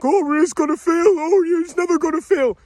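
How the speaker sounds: high, sing-song mockery; mocking voice. Ranting at viewers, high mocking parody of the complacent. Mocking those who trust the system.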